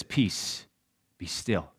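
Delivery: low, soft, steady calming words; calm command. Acted. Jesus speaking to the wind and sea, low and calm. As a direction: hush the storm with quiet authority.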